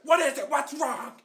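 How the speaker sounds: high, very loud alarmed yell; hammed-up shrieking mom in alarm; panicked mom shout. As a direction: burst in shouting like a frantic mother. Mom rushing in after a scream.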